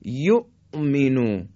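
یأتیه and یأمرکم slow, with the silent hamza caught sharply in the throat; sharp sakin hamza. Shown as a demonstration. Teacher demonstrating the sakin hamza slowly. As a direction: stop hard on the hamza in یأمرکم. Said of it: "این طرز تلفظ همزه در حالت سکون".

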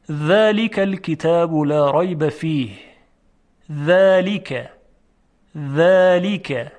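ذلک with a long thin voiced th; delicate dhal example. Shown as a demonstration. Teacher giving another example, drawn-out careful ذلک. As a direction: recite carefully, lengthening the th and vowel of ذلک. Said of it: "خب، یک مثال دیگه".

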